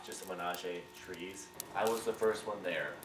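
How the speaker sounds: ordinary talk, nothing marked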